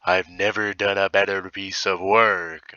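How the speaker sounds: understated self-satisfied pride; pleased with himself. Acted. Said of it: "I said to myself happily".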